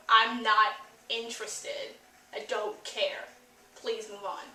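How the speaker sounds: tune out, acting utterly uninterested; deadpan boredom trailing away; bored indifference. Acting out boredom during a scene.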